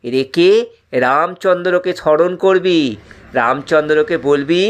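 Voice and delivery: usual speaking voice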